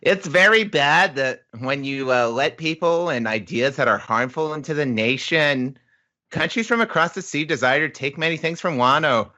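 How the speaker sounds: high, reciting schoolkid voice; child pupil. Acted. Next child answering the teacher, high put-on kid voice. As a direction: recite it like a dutiful small child. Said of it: "this next child says".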